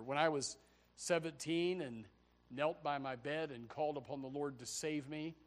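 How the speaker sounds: ordinary delivery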